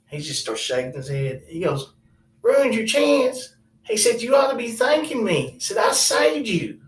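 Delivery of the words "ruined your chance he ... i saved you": scoffing uncle. Retold or imitated. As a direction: scoff as if he should be grateful.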